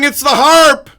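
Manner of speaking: excited reveal. Real, not acted. Host reveals surprising answer.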